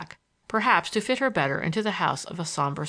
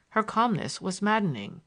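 ordinary delivery

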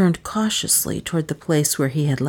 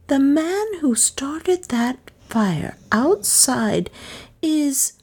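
Miss Cornelia realizing; 8 semitones higher than usual. A narrator's reading. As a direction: build the accusation, stretching key words higher. Narrator voices Miss Cornelia's mounting realization.

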